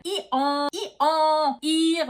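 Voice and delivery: hee-haw ending in a nasal French vowel; donkey bray. Shown as a demonstration. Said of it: "meeting a donkey again".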